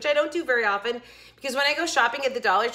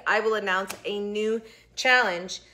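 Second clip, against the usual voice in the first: normal talking voice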